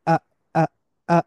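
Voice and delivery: আ started suddenly from a closure; short, hard-onset আ; abrupt Arabic hamza. Shown as a demonstration. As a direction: pop each আ from a glottal stop. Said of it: "একটা বাধা থেকে শুরু হয়".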